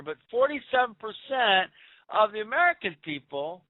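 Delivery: ordinary talk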